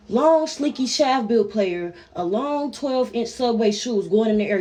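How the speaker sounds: ordinary delivery, nothing marked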